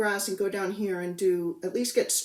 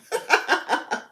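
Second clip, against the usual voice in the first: loud, higher-pitched burst of laughter; 7 semitones higher, 6 dB louder than usual. Real, not acted. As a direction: laugh out loud in a quick run. Laughs at her crowded schedule.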